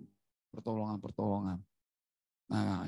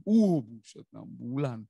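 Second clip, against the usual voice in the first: shocked exclamation; 7 dB louder than usual. Real, not acted. Shock at six-month prognosis.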